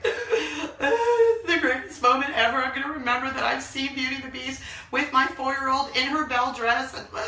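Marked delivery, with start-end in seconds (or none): 0.0-4.2 laughing through tears. Real, not acted. Mom recalling her daughter in the dress, laughing and teary. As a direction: laugh and choke up on it.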